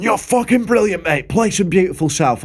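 high-pitched mock enthusiasm; imitating gushing punter. Drunk punter begging the singer at gig's end, high mock gushing. Voices pissed punter pestering him.

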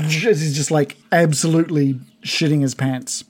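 normal talking voice

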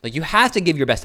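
mock aggressive trainer push. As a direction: bark it like a hype coach. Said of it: "I have a bit of an aggressive tone".

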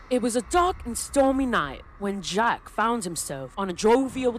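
put-on theatrical storyteller in a loose British accent. Telling the Jack-o'-lantern legend, theatrical loose British accent. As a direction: tell it grandly in a British accent, pausing between phrases. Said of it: "I did, like, a loose accent".

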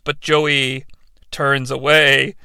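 ordinary talk, nothing marked

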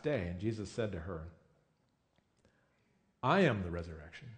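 a preacher reading the gospel text; scripture reading. Acted. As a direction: read the passage with reverent care. Reads John 11 scripture aloud.

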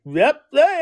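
mock eager agreeing; eager single guy. Single guy at the party, lightly voiced eager yes.

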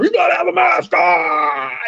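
high, slow shouted order; cashier yelling. Retold or imitated. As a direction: bark it across the store like a cashier. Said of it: "one of the cashiers yelled at her".

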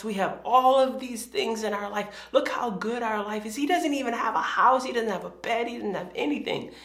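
put-on high-pitched, earnest kid reasoning; pleading big sister. Older daughter reasoning with her sister, pleading.

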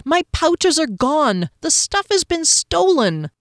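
high, slow dismayed cry; distraught elf finding pouches gone; alarmed. Acted. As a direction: panic over the theft. Continuing alarm.

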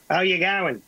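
light Aussie barman voice; Australian accent. Retold or imitated.

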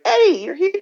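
high, loud, thrilled call; mock excited greeting. Friend bursting into his room, high and loudly excited. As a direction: imitate a friend bursting in with joy.

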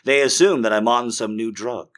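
normal talking voice

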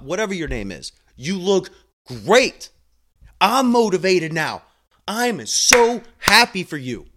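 fired-up, lifted voice cheering on a patient; emphatic motivator, proud and excited; excited pep talk. Acted. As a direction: hype them up with heartfelt energy.

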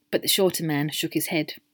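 ordinary delivery, nothing marked

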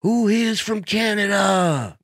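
high, slow cheesy crowd question; hack crowd work. Retold or imitated. Comic quoting Dice's pandering crowd line. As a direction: drag it out like a hack opener. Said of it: "you go".

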